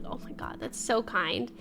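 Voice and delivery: soft breathless whisper; whispered awe. Retold or imitated. Streamer reliving the kind gesture, whispering. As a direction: whisper it, touched. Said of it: "I was like".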